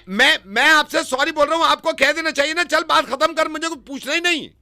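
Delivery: very high, loud insistence; frantic shouted protest. Pretend. Caller demanding the matter be dropped, frantic high shouting. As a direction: shout over him without letting up.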